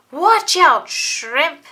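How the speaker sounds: high, loud sneering jab; mock the small kid; taunting bully.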